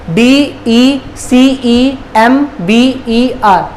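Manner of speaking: slow, letter by letter; spelling out. Shown as a demonstration. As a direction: spell it out slowly with a gap after each letter. Spelling the month for learners. (एक-एक अक्षर धीरे-धीरे)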